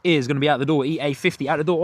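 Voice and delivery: normal talking voice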